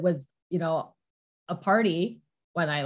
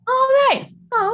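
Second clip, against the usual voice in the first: delighted squeal; 8 dB louder, slower than usual. Real, not acted. Delighted reaction to excited dog.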